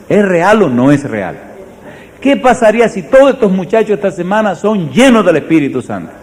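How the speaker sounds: high, loud fervent preaching; preaching at full force. Acted. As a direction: drive the question home to the congregation. Preaching climax to the youth.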